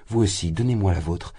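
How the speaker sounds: ordinary delivery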